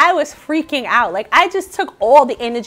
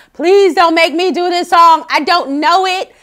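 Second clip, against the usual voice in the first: raised-pitch mock begging; mock panicked pleading; 5 semitones higher, 5 dB louder than usual. Singer retells dreading the next song, mock pleading. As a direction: plead as if dreading it, half in fun.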